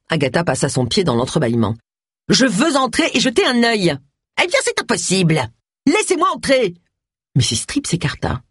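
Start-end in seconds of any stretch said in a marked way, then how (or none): 2.3-6.7 high-pitched, acted standoff at the door; insistent demand. A narrator's reading. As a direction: demand it with the voice pushed up. Forcing her way past a closing door. (exiger d'une voix haute et insistante)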